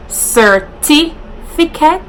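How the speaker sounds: careful "sur-ti-fi-cate"; proper pronunciation. Shown as a demonstration. YouTuber showing the correct form, slow and high. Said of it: "instead of certificate".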